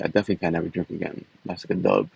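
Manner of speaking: usual speaking voice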